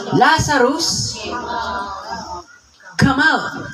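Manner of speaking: high calling voice, not full volume; held-back shout. Shown as a demonstration. Preacher calling Lazarus out of the tomb, held-back high call. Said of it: "Hindi na po ako sisigaw ng todo".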